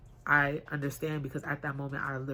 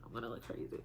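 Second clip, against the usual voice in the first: a soft, lower whisper of inner worry; anxious whisper; 4 semitones lower, 8 dB quieter than usual. Retold or imitated. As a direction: whisper it to yourself in dread.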